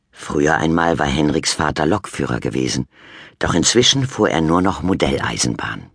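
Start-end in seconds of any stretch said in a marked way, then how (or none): none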